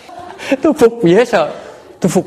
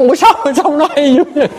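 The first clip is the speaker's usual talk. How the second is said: high, laughing "how do you talk so well"; laughing amazement; 13 semitones higher, 4 dB louder than usual. Retold or imitated. Teacher marveling at the boy's sweet talk, laughing. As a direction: marvel at him through a laugh.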